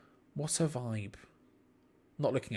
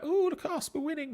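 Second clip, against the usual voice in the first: sarcastic gloating; 3 dB quieter than usual. Retold or imitated. Rival fans crowing at four nil up, sarcastic. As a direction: imitate smug fans in a mocking high voice. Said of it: "sarcastic, like".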